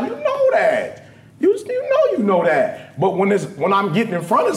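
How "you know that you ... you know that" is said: high, slow, laughing self-scold; exasperated inner voice; scolding self-talk. Retold or imitated. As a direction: chide yourself with a laugh. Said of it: "I'll hear a word like".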